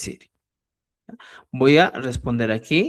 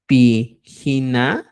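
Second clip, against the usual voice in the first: slow model word; 4 dB louder, much slower than usual. Shown as a demonstration. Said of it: "puedo preguntarte".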